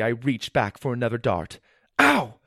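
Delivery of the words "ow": sudden high yelp of pain; shouted in pain. A narrator's reading. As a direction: cry out at a sharp prick. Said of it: "I shouted".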